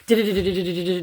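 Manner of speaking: fast mouth-made drum roll; rapid rolling "du-du" noise; imitated drum roll. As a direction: rattle off the suspense drums. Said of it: "then the drums come".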